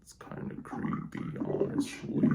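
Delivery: low monster filter; monster voice effect. Shown as a demonstration. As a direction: voice changer growl. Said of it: "Monster voice".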